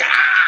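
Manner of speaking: high imitated scream, drawn out; mock battle scream. Imitating her grabbing him by the neck, mock scream. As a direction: let out a mock attacking yell. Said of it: "she's like".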